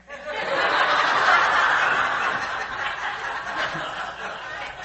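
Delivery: breathy high-pitched real laughter; real laugh at the joke; high breathless laugh. Real, not acted. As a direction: burst out laughing, high and airy. Audience laughs at punchline.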